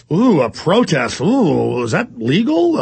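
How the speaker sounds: slow, hammed-up prissy shock at a protest; mock stern lady. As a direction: play the scandalized newsreader, drawn out. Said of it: "she acts real stern".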